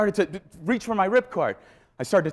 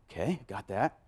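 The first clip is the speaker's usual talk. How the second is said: meek reply; 4 semitones lower, 8 dB quieter than usual. Retold or imitated.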